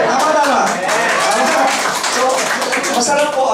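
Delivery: a loud, high burst of laughter; laughing. Real, not acted. Speaker laughing at own joke to the crowd, loud. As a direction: laugh out loud, then agree.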